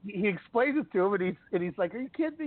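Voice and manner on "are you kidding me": sharply pitched-up disbelief; are you kidding, voice shooting high; retold star shocked at the claim; incredulous Kobe. Kobe's retold reaction.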